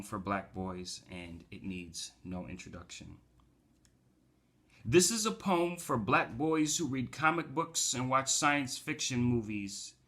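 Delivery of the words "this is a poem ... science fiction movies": high, declaimed poetry opening; raised performance voice for verse; spoken-word poem. Acted. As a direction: recite it to an audience as a poem. Begins reciting second poem.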